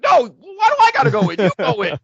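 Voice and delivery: high, loud whiny protest. Retold or imitated. Valentine refusing to enter first, high whiny protest. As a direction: squeal it like a panicked coward. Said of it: "Valentine's like".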